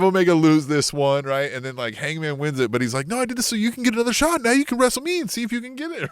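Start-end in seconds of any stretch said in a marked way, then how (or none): 3.1-6.1 high, eager, quick role-play; pitched-up insistent wrestler; acted wrestler explaining himself. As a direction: protest in a raised, hurried voice. Acting out Hangman's reply.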